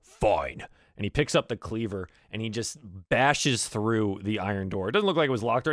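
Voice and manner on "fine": grudging growly grumble; reluctant orc muttering under protest; grumbling orc. Acted. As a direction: grumble and groan before giving in. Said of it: "grumbles a little".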